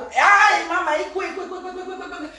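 high, hammed-up cry for mama; mock wail.

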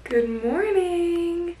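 high, slow sing-song greeting. Real, not acted. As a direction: greet them brightly.

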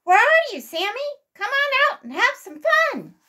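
very high, loud, eager call. A narrator's reading. Squirrel boys call Sammy out to play, calling out. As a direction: call a playmate out to join the fun. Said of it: "two voices called together".

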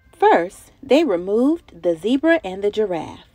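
lively, sing-song picture-book reading; reading to children. A narrator's reading. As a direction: read it like story time for little kids.

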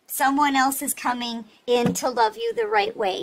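ordinary talk, nothing marked

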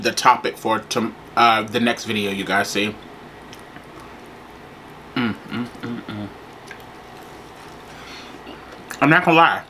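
chewing while humming; talking mid-bite; mouth full of food. Real, not acted. Seen 1: Bites taco at +0.1 with hand at mouth, then keeps chewing.

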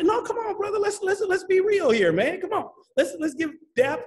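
high-pitched, animated insistence; excited, insistent urging; emphatic urging. Real, not acted. Insisting the guest deserves praise.

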